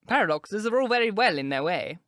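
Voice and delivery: a hint of a stiff old politician objecting; stiff baronet. A narrator's reading. Sir Thomas voiced, rejoined.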